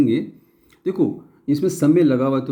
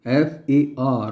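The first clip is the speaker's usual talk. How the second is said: slow spelling out; much slower than usual. Shown as a demonstration. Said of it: "ए की जो आवाज़ आ रही है वो आ की".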